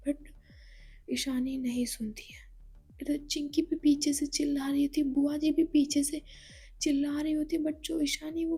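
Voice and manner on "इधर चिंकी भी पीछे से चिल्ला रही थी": ordinary delivery, nothing marked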